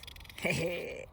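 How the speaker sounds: wolf's gloating giggle; wicked chuckle. A narrator's reading. Wolf about to gobble the girl, wicked chuckle. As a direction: chuckle greedily before the pounce.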